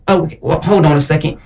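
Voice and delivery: normal talking voice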